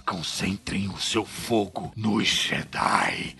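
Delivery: very low, slow, heavy villain voice with lots of air; weighty, airy command with cold contained rage; Agent Kalos. Shown as a demonstration. Imperial villain ordering fire on the Jedi, low, slow and heavy. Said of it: "tudo tem esse peso".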